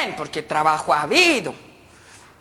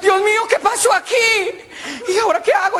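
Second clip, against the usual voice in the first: high, laughing scandalized lady; a lady caught off guard, high and giggly; shocked lady; 12 semitones higher than usual. Acted. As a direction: gasp it like a flustered señora. Shocked lady sees parrots.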